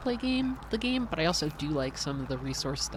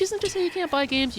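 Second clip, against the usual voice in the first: high-pitched backpedal; 6 dB louder than usual. Real, not acted. Quick backpedal, much higher.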